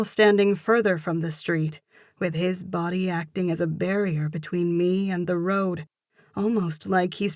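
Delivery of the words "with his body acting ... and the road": plain reading voice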